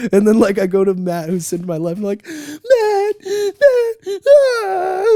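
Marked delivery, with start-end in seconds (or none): 2.3-5.1 high, strained squeal of mock panic; hushed freak-out. Re-enacting grabbing a friend in the theater, freaking out in a high squeal. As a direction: imitate yourself freaking out, squealing high while trying to hold it in. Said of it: "freaking out. I'm trying to be quiet".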